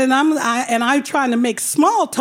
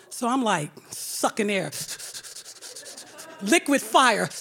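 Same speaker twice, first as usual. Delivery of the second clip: normal talking voice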